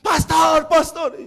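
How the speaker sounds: high-pitched cry imitating a woman calling out; acted shout, pitched well up; imitated yelling. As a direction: call for the pastor like an alarmed woman. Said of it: "y gritaba".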